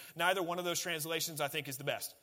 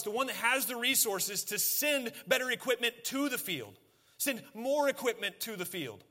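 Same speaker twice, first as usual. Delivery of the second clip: higher, louder sermon build on the harvest; full-force preaching; 6 semitones higher, 3 dB louder than usual. Acted. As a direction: preach it out with rising urgency.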